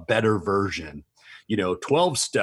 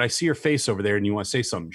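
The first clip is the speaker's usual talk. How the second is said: ordinary talk, nothing marked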